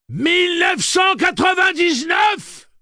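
slow, very high full-force scream; drawn-out all-out shout; desperate yell. A narrator's reading. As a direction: howl the number through a door. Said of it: "hurla Eddie à travers la porte".

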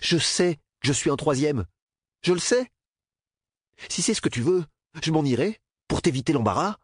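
high, bitter, near-shouting apology; bitter outburst. A narrator's reading. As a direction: fling the apologies in anger. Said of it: "manque lui hurler dessus".

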